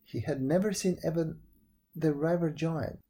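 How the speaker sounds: ordinary delivery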